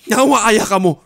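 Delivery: usual speaking voice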